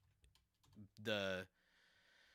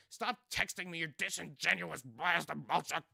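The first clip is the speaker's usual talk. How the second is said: loud rant collapsing into garbled babble; mock press secretary losing it, words turning to gibberish; hammed-up furious sputtering, raised pitch; Carolyn Levitt; 4 semitones higher, 7 dB louder than usual. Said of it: "That's my Carolyn Levitt impression".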